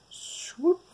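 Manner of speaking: whooshing brush-stroke sound effect. Shown as a demonstration. Voicing the brush stroke sweeping.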